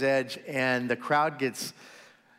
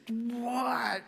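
stunned what; 11 semitones higher, 3 dB quieter than usual. Acted. Peter stunned by the catch.

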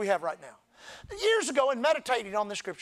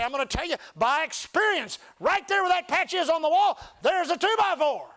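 retold preaching; 6 semitones higher, 4 dB louder than usual. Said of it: "I get up and preach, and I say".

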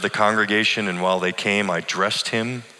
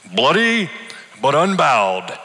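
a dying man's quoted poetry, higher and louder; mumbled defiance; 5 semitones higher, 4 dB louder, slower than usual. A narrator's reading. Sick old man quoting poetry to his pastor, defiant. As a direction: quote the poem with defiant pride. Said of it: "He mumbled".

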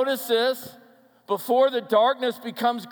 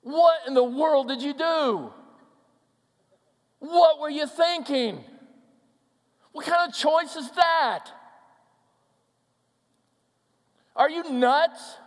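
high, raised, incredulous questions; exasperated disbelief, pitched up high; acted outraged scolding; 7 semitones higher, 4 dB louder than usual. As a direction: scold them as someone badly let down. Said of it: "What wasn't asked is".

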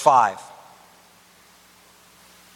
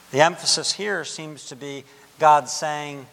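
ordinary talk, nothing marked

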